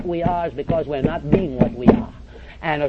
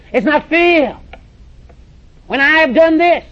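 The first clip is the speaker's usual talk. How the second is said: higher, louder indignant whine; petulant protest, pitched higher and louder; mock whining complaint; 9 semitones higher, 8 dB louder, slower than usual. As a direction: ham up the aggrieved pastor. Mocking a complaining preacher.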